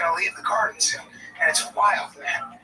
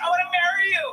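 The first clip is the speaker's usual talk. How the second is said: high, louder lovestruck gush; swooning fan girl; 9 semitones higher, 4 dB louder than usual. Retold or imitated. Girl writing fan mail, gushing to him. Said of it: "This girl's like".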